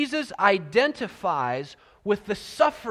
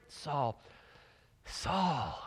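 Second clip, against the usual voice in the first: a soft, hurting call, softer; heartbroken; 4 semitones lower, 7 dB quieter than usual. Shown as a demonstration. Jesus calling Saul with a breaking heart, soft. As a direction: say his name twice like your heart is breaking. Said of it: "When, when your heart is kind of break".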